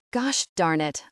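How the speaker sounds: plain reading voice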